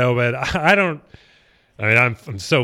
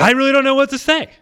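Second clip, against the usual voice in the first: overwhelmed; 13 semitones higher, 5 dB louder than usual. Real, not acted. Overwhelmed by invitation.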